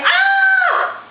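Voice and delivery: high startled scream. A narrator's reading. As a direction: shriek in fright. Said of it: "jumped back and screamed".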